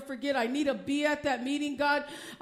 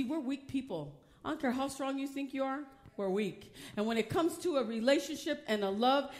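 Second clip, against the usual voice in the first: usual speaking voice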